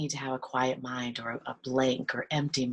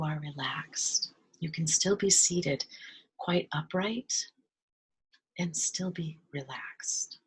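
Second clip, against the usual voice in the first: guided meditation. Real, not acted.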